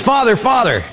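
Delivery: high, urgent imitated call; eager high-pitched hail; calling for attention. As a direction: imitate a kid running up calling. Kid runs up calling to him.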